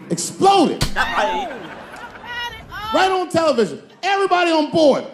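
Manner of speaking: high, loud telling of the disaster; dramatic outburst. Real, not acted. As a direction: blast out the tragedy. Dramatic space shuttle telling.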